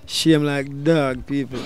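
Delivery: normal talking voice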